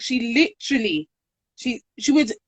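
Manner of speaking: ordinary talk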